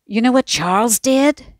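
demanding. A narrator's reading. Laurie following his mother in, demanding she listen. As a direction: insist she hear the news. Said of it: "he demanded".

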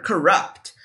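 normal talking voice

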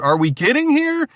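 high-pitched incredulous question; excited disbelief. Real, not acted. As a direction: ask it as if it's too good to be true. Reacting with disbelief to merchandise.

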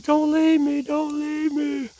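high, drawn-out mock plea; pleading pet voice. Pet begging its owner not to go, high mock pleading. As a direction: plead in a high little pretend voice. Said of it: "It's like".

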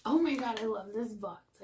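ordinary talk

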